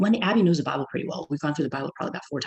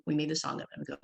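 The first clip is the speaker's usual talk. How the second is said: usual speaking voice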